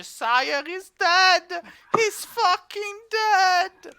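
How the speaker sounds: high, drawn-out crowd cry without real volume; mock screaming. Dwarves rushing across the village with news, mock screaming. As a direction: stretch out a high pretend scream.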